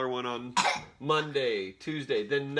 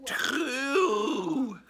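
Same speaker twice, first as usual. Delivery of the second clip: surprised; 12 semitones higher, 4 dB louder than usual. Real, not acted. Surprised at son's answer.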